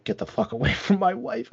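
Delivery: high, hammed-up jealous husband snarl, breaking into a laugh; mock angry threat. Jealous husband grabbing him at the party, hammed-up threat.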